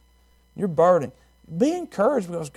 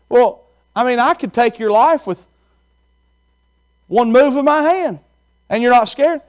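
higher, louder challenging husband; husband testing her; 8 semitones higher, 6 dB louder than usual. Acted. Captain challenging his wife, higher and louder. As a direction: press her with the question. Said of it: "he said".

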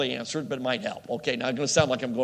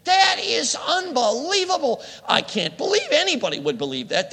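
mock disbelief; 11 semitones higher, 4 dB louder than usual. Driver reacting to liberal talk radio, mock outrage. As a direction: act out scoffing at liberal radio. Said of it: "'Cause you think".

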